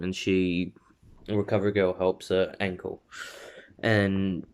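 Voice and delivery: usual speaking voice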